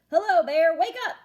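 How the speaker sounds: loud, high wake-up call. Acted. Hare calls loudly to wake sleeping Bear. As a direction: call him out of his sleep.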